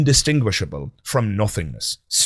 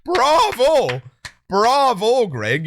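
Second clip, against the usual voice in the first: mock bravo; 12 semitones higher, 3 dB louder, much slower than usual. Host mock-applauds Greg's admission.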